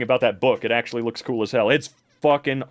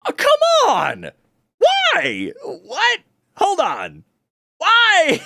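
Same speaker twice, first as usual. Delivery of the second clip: high, loud, drawn-out protest; exasperated outburst; 5 dB louder, much slower than usual. Real, not acted. Reader appalled by that stupid wiki, exasperated outburst. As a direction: cry out in exasperated disbelief. Streamer reacts to what they read.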